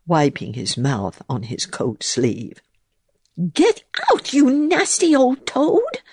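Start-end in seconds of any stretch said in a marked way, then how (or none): 3.5-6.0 very high, shrill bee voice; shrieking bee. A narrator's reading. Angry bee shrieking at the toad, very high. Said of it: "shrieked Babbity Bumble".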